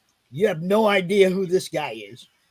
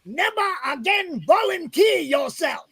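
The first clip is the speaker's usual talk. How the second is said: high, slow shouted acronym; drill instructor shout; 10 semitones higher than usual. Retold or imitated. Drill instructor explaining the Navy acronym, yelling slowly. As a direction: spell out the rule in a slow high yell.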